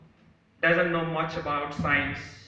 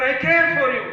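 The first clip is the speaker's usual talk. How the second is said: very high, very loud shout; a child shouting to the hills; top of voice; 10 semitones higher, 10 dB louder than usual. Acted. Little boy to the mountains, top of voice. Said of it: "at the top of your voice".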